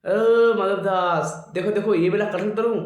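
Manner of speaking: loud, high calling out on purpose; deliberate shouting. Acted. God calling loudly down to his devotee while stealing jackfruit, deliberate shouts. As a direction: holler across the orchard so everyone hears. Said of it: "जानबूझ के चिल्ला रहे हैं".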